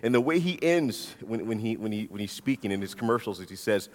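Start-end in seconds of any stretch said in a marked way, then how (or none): none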